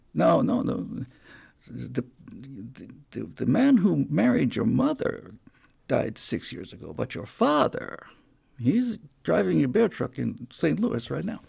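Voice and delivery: hushed, knowing retelling of the punchline; palm reader's punchline. Retold or imitated. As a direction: savour the twist quietly, knowing you win.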